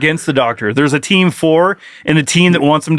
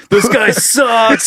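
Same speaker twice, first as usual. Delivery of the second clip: heckling shout; 6 semitones higher, much slower than usual. Pretend. Mock heckler in stands.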